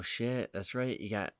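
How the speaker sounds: ordinary delivery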